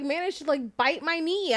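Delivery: usual speaking voice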